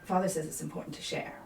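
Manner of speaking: put-on the sulky child's mood drops all at once; sulky, fast and soft; suddenly flat child. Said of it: "just fall immediately and go kind of flat".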